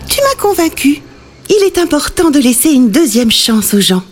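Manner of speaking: queen voice. Acted. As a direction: speak as a kind but firm queen. Queen speaks to witch.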